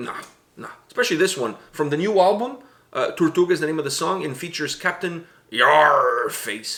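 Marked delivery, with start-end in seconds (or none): 4.8-6.7 slow, drawn-out pirate growl. Acted. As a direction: announce it like a pirate captain. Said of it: "Oh, that was a good Yarface".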